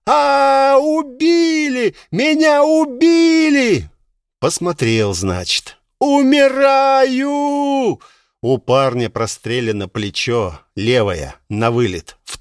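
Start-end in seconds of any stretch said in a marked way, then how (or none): 0.1-3.9 high, slow wail of the wounded; pained wail. Acted. Wounded young man crying out. 6.0-8.0 high, drawn-out howl; dying shout. Acted. Wounded man crying out.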